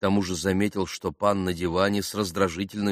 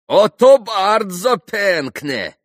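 high, loud, drawn-out cry; shouted approval; 12 semitones higher, 11 dB louder, much slower than usual. A narrator's reading. Other Polish gentleman approving the toast, shouting. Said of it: "крикнул другой пан".

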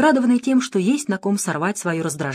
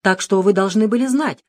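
plain reading voice

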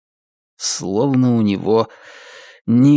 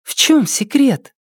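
put-on high sleepy child voice; drowsy little girl asking; 13 semitones higher than usual. A narrator's reading. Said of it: "уже сонная".

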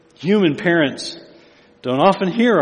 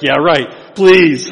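sarcastic disbelief; 10 dB louder, much slower than usual. Retold or imitated. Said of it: "and you're like".

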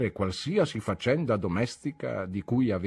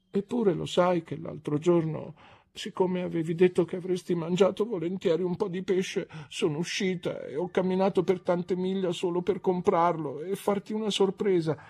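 put-on a touch of tearful young wife, higher; lightly voiced hurt young wife; 9 semitones higher than usual. A narrator's reading. Young wife reminding husband of the fish, lightly hurt. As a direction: reproach him gently as a hurt bride. Dora's voice held.

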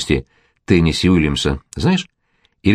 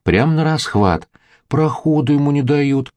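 slow, mocking and teasing, rising higher at the end; snide little voice; 9 semitones higher, slower than usual. A narrator's reading. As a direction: tease him with sarcastic pity. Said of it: "ехидным голоском сказала Ася". (ехидным голоском, насмешливо)

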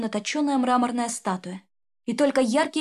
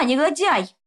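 raised, louder accusing cry; angry shout; 5 semitones higher, 5 dB louder, slower than usual. Acted. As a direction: hurl the insult at him. Dramatic accusation in story. (гневный выкрик)

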